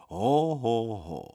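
deliberate spoken "ho, ho, ho" laugh; hearty acted laugh. A narrator's reading. Doctor laughs at the boy's cheek with a hearty mock laugh. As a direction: laugh in slow, round hos. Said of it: "zasmál silným hlasem".

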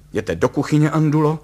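forceful master's command. A narrator's reading. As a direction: send the maid out with authority. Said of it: "kázal služce".

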